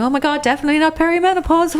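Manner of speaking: put-on high, quick ditzy young-girl voice; mock young girl. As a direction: play a breezy young girl. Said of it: "acting really young and going".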